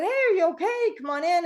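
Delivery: high call toward the water. Retold or imitated. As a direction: coax him back in, calling. Calling swimmer back to shore.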